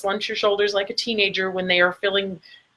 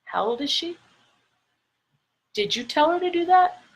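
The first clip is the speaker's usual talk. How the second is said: amazed guests; 8 semitones higher, faster than usual. Retold or imitated. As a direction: ask it in disbelief. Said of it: "those people were like".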